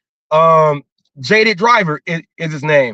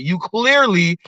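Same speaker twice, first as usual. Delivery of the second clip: normal talking voice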